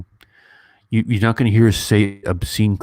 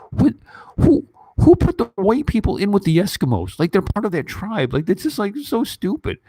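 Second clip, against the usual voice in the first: incredulous; 12 semitones higher than usual. Real, not acted. Mocking the casting.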